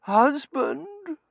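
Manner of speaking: very high, wavering swoon voice; theatrically feeble, high-pitched; weak invalid wife. A narrator's reading. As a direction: call for husband as if coming round from a faint. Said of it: "she said weakly".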